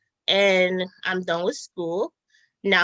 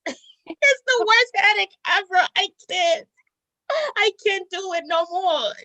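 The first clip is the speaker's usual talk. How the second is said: laughing; 10 semitones higher than usual. Real, not acted. Laughing while recounting bad dates.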